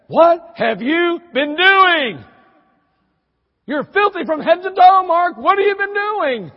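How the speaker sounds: high, loud, slow scolding yell; a shocked mom bellowing at her dirty boy; mother shouting. Retold or imitated. Mother at the window yelling at her filthy son, high and loud. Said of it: "She said".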